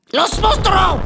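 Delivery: sudden loud high boo; scary shout. Acted. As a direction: jump out and shout to scare. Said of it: "T'as eu peur, hein?".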